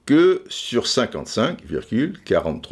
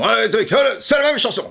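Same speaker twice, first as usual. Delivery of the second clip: Michel Drucker impression; 12 semitones higher, faster than usual. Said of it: "j'imite très mal".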